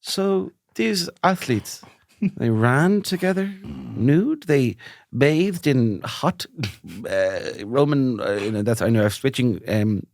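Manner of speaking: slow, far-off musing voice; a child lost in a daydream; dreamy child. Retold or imitated. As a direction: muse like an enchanted kid. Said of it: "you were like".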